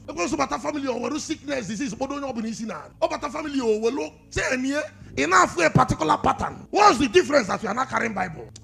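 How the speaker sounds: fast, loud, driving pulpit patter; quick-fire sermon in Igbo and English; rapid preaching. Acted. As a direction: rattle it off to stir them.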